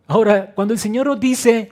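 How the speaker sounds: preacher booming Jesus' promise to grieving listeners; full-force preaching. Acted. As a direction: declare "No llores" as a promise.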